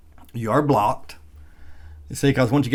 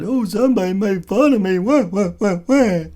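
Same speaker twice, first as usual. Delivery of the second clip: high, loud mock childish whine; mocking whiny kid; 9 semitones higher, 6 dB louder than usual. Mocking a hater as an upset kid, high childish whine. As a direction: mimic an upset twelve-year-old, higher and louder. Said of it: "does he really think I'm twelve years old".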